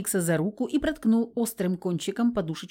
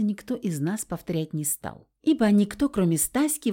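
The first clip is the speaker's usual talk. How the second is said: usual speaking voice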